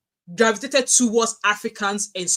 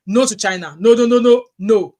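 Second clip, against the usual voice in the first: loud, higher rapid-fire no's; emphatic refusal; 3 semitones higher, 10 dB louder than usual. Real, not acted. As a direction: refuse it outright, loudly. Firm refusal of China.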